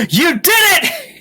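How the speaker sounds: high, drawn-out mock cheer; overjoyed. Baseball dad learning his son already won, hammed-up joy. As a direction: burst out in put-on triumph.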